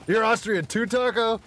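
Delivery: high, loud mocking impression of a chatter; teasing impression asking Taco if he's Austrian too. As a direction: ham up someone who mixes up Austria and Australia. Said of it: "That was a quality impression".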